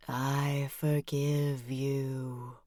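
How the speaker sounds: very slow soft ghostly voice; spooky forgiveness. Acted. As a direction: forgive him gently as a ghost. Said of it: "in my spooky voice".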